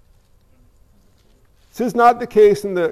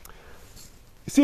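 whispered aside; 12 dB quieter than usual. Real, not acted. Preacher leans in to whisper.

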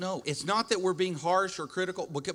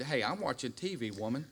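mock grumpy husband; 2 semitones lower, 7 dB quieter than usual. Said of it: "I certainly would not have said".

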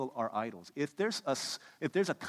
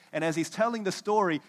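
usual speaking voice

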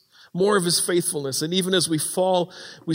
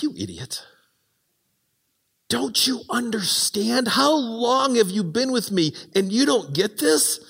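lightly voiced exasperated teacher; mock exasperated Jesus; 4 semitones higher than usual.

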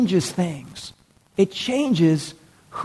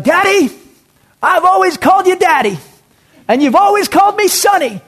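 high, loud impassioned shout; yelling out; 13 semitones higher, 6 dB louder than usual. Retold or imitated. Evangelist in upper room yells to God, high impassioned shout. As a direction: yell it to heaven at full voice. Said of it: "he's yelling out".

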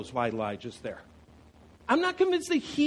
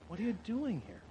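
soft question; 9 dB quieter, faster than usual. Acted. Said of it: "But the Lord said to you".

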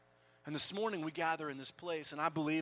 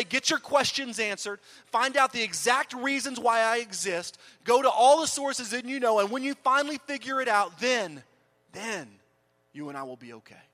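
demanding lecture; 6 semitones higher, 9 dB louder than usual. Acted. Mock demanding God voice in sermon.

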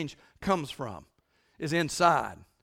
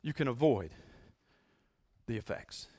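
quiet resolving whisper of promise; hushed ending; 8 semitones lower, 8 dB quieter than usual. Acted. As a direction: drop to an intimate hush. Preacher drops to a hush.

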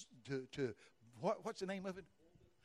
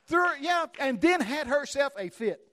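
normal talking voice

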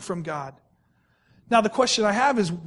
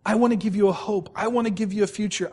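ordinary talk

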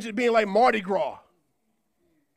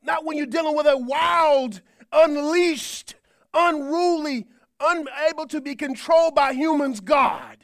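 louder and higher, preaching hard; rising preaching shout; 6 semitones higher, 5 dB louder, slower than usual. Real, not acted. Preacher proclaiming an untamed God to the church, loud and high. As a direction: lift it up and proclaim it.

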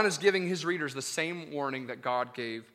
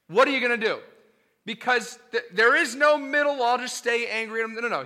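raised, urgent preacher voice; full-force preaching; 6 semitones higher, 6 dB louder than usual. Acted. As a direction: drive the point home hard from the pulpit.

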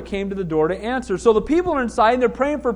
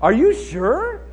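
drawn-out doubt, swooping up at the end; slow mock disbelief, each question rising steeply; incredulous questioning; much slower than usual. Pastor hearing her cancer is gone, openly incredulous. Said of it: "I go".

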